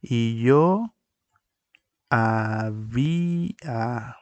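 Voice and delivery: "y yo había" drawn out very slowly; slow teaching demo; slow syllabified example. Shown as a demonstration.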